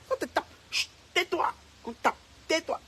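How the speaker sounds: high, loud mock scolding parent; imitated harsh hushing. Acting out the parent silencing a beaten child, loud and high. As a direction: bark "tais-toi" at the child like a strict parent. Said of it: "on te tape à fond, on fait chut".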